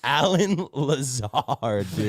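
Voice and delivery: very slow, drawn-out name, fighting laughter; struggling not to laugh. Real, not acted. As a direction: stretch the name out as you try not to laugh. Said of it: "I can't even say his name without laughing".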